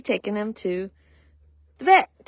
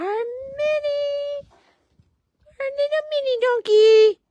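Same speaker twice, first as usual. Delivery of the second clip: very high, slow doting voice; 6 dB louder, slower than usual. Real, not acted. Owner introducing her mini donkey, high doting baby talk. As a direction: gush over the little donkey.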